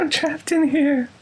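high, plaintive trapped voice; mock-pitiful high plea; creepy voice. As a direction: plead in eerie falsetto from inside a machine. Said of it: "creepy voice for, like, three minutes".

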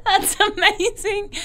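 slow, laughing sob; crying with laughter. Real, not acted. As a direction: squeeze it out through laughing tears. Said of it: "I'm gonna cry".

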